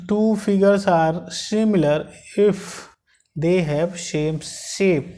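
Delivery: slow, drawn-out reading for notes; teacher dictating the definition; slow dictation. Shown as a demonstration. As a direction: dictate the line slowly.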